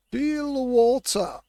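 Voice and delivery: high, loud and slow sung-out call; high stretched cry; drawn-out call. Acted. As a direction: croon it out to the sea. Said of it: "repeats louder, stretched".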